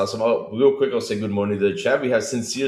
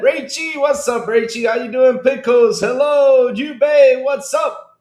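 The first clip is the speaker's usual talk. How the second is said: much higher and louder, hyped-up hellos; excited greetings; 14 semitones higher, 8 dB louder, slower than usual. Real, not acted. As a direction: greet each viewer like a hype man. Host greets chat viewers by name.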